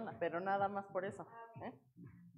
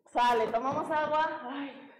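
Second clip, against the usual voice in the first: ordinary delivery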